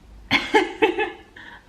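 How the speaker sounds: breathy high cackle; high gleeful laugh. Real, not acted. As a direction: laugh in delight. Laughs at punch in book.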